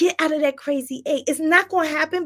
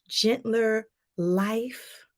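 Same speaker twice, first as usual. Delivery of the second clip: ordinary delivery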